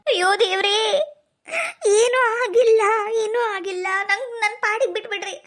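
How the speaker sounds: hammed-up self-pitying lament; tearful wailing. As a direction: moan through sobs for sympathy. Staged crying to worried family.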